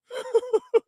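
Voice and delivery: short high burst of laughter. Real, not acted. Laughs again.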